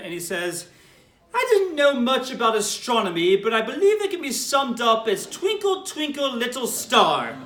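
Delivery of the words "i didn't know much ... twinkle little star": put-on Rabbi Refson's British accent, dry wit; British accent, slowing on the punchline. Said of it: "has a beautiful British accent".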